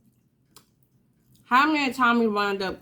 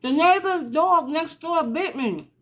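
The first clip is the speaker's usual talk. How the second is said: put-on higher, tearful kid's voice; upset child; 9 semitones higher than usual. Child telling mother the neighbor's dog bit her, upset. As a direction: tell it like a hurt little kid. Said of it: "And he like".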